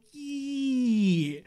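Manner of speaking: high, loud, long falling squeal; stretched-out toddler echo, loud and high; baby babble imitation. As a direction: mimic a baby copying a drawn-out word. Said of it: "you hear her start going".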